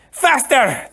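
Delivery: high, loud snapped order; the Sicilian urging the giant on, shouting; commanding shout. Acted. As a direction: bark it down at the giant. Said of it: "the Sicilian commanded".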